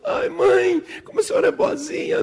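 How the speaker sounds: put-on high whimpering child voice, trailing into sobs; tearful childish squeak; weepy little girl. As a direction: melt into grateful mock crying. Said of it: "Não chora mais".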